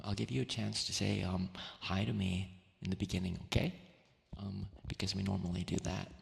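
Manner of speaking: ordinary delivery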